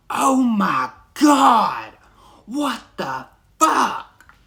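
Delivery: mom's shocked, outraged crying shout; furious shout. Retold or imitated. Mom catching her son peeing on people, outraged shout. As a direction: yell it like a mother catching her kid mid-act. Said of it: "she said".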